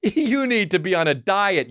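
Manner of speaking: high, slow mocking voice; mock the scolding family in an ad; commercial family. Family scolds dad in a commercial, mock scolding voice. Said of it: "And they're like".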